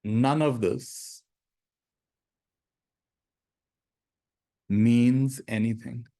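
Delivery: slow, deliberate meditation phrases with long silences; guided meditation. Acted. Teacher giving students lines to repeat in their minds, slow meditative delivery. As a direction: lead them slowly through each phrase, leaving space to repeat it.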